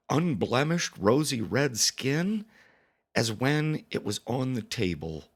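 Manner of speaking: ordinary delivery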